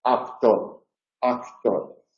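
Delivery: actor, k softened like a D, said softly; relaxed voiced k. Shown as a demonstration. Teacher repeating the model pronunciation quietly. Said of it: "es una especie de D".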